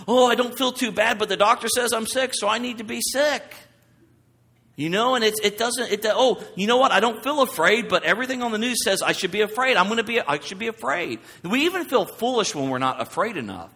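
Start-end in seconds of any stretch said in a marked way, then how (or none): 0.1-3.5 mock worried patient. 6.0-11.2 mock fearful viewer. Mimicking a fearful news watcher.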